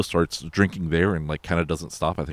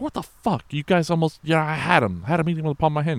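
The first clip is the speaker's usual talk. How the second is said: mock outrage; 12 semitones higher than usual. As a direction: raise the voice in mock indignation. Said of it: "It's like".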